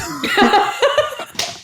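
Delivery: airy high-pitched giggle; high breathy laugh. Real, not acted. Laughing at the nurse's remark.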